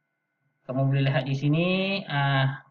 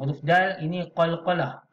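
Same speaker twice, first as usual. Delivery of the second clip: usual speaking voice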